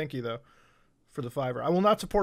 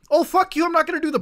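higher outburst; 8 semitones higher, faster than usual. Real, not acted. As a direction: fling it out sharper the second time. Realizes the posts are ads.

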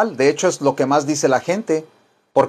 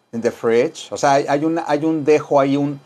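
ordinary talk, nothing marked

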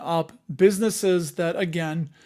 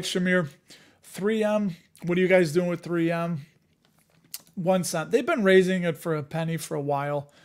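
usual speaking voice

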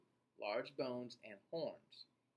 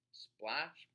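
ordinary delivery, nothing marked

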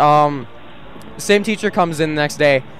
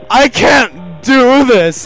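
loud, slow, higher mock wail; hammed-up despair; 5 semitones higher, 8 dB louder, much slower than usual. Student fleeing the substitute's improv task, mock despairing wail. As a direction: wail it out, dragging the words. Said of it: "pretends to cry".

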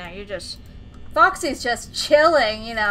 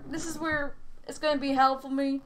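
ordinary talk, nothing marked

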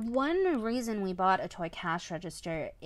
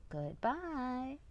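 higher, softer, drawn-out British goodbye; mimicked British accent; 5 semitones higher, 6 dB quieter than usual. Echoing the toy cash register's farewell, mimicked British accent. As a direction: echo the toy's British lilt.

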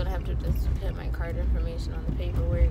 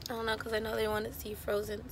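hoarse, losing her voice; strained hoarse voice; raspy whisper; 9 dB quieter than usual. Real, not acted. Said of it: "Sorry, I'm losing my voice".

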